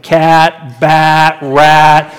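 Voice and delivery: mock lazy Adam running out of effort; super lazy. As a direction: throw the names away like a lazy man at day's end. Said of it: "you know, super lazy".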